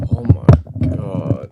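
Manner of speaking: cold, shivering exclamation; shaky from the freezing cold. Real, not acted. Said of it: "I'm freezing right now".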